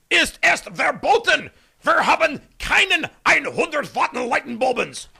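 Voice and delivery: mock German.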